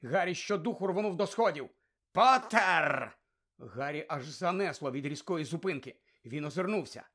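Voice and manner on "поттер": loud, drawn-out shout of a surname; stern call. A narrator's reading. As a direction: halt a pupil with one shout. Teacher calls sharply down corridor. (суворий окрик)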